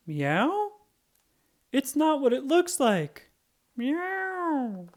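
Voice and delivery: high, flustered fake meow; caught dog faking. Roy caught barking tries to pass as a cat, flustered high. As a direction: cover it up with a silly meow.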